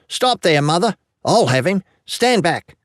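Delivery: high-voiced urchin giving orders; eager, high boyish voice; excited boy. A narrator's reading. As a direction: take charge like a plucky young lad. Excited boy takes charge of snake hunt.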